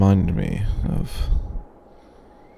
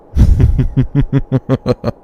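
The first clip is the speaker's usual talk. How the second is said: loud, scornful dragon laugh; haughty cackle; mocking laugh; 9 semitones higher, 7 dB louder than usual. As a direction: laugh off the cheek of a mortal. Mocking reply to listener.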